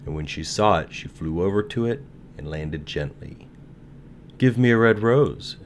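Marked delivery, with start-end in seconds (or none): 4.4-5.6 raised, pleading call; cried. A narrator's reading. Nightingale asks the rose tree, crying out. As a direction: call it out louder and higher. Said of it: "she cried".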